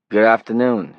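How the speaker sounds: put-on a city stranger greets Johnny, loud Brooklyn accent; flat Brooklyn accent. A narrator's reading. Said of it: "He had a flat city accent as well".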